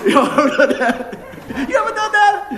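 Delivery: high, loud question through real laughter; cracking up. Real, not acted. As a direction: ask it while helplessly laughing. Cracking up at fish trick.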